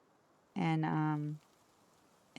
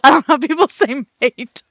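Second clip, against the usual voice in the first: laughing; 8 semitones higher, 16 dB louder than usual. Real, not acted. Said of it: "laughing through the line".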